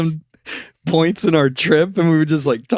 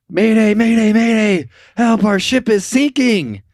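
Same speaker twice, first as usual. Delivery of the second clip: higher, louder mock mayday call; distress call; 9 semitones higher, 4 dB louder than usual. Sinking sailor calling the coast guard, higher mock panic. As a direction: call it like a sinking ship over the radio. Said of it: "over the radio goes".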